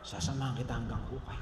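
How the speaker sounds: low and hushed, a quiet vow; soft devoted promise. Retold or imitated. Fourth wife promising to follow him to the grave, softly. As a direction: murmur it like a loyal wife.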